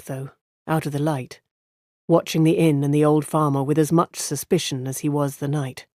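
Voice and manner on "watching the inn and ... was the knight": usual speaking voice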